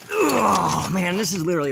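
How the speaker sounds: loud strained groan of effort; lifting something very heavy; straining. Real, not acted.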